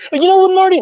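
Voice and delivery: high and loud call to Marty; sudden high, loud call to Marty. Real, not acted. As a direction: call out to get his attention. Calling out to friend.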